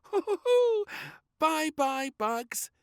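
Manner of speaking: very high, loud giggle; a high, loud giggling girl. A narrator's reading. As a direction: giggle bugs goodbye. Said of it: "giggled little Princess".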